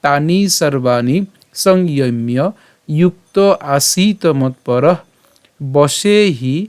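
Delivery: slow, measured shloka recitation; Sanskrit verse recitation. Shown as a demonstration. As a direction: draw out the long vowels, pausing between phrases. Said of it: "আমি যদি আবার প্রথম থেকে বলি".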